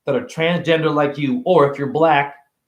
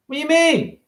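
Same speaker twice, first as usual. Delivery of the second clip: put-on higher little kid voice; 12 semitones higher than usual. A kid answering a stranger, higher small voice. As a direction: answer like a shy ten-year-old.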